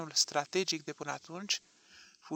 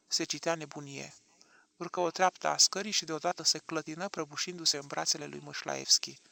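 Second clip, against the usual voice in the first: ordinary delivery, nothing marked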